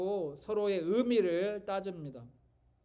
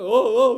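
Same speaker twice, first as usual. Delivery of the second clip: loud mock sobs, pitched up; imitated sobbing; 3 semitones higher, 15 dB louder, much slower than usual. Pastor imitating his wife crying on a trip, loud mock sobs. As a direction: wail the way the wife cried. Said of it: "막 우는 거예요".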